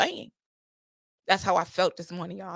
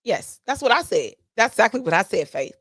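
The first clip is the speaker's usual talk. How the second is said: ordinary talk, nothing marked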